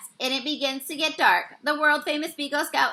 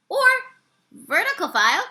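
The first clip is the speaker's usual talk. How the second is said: ordinary delivery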